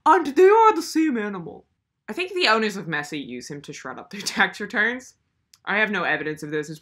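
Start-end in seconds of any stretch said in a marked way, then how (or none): 0.0-1.6 mocking online idiot. Mocking idiots online who call it cute, in a dopey high voice. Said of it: "idiots online are like".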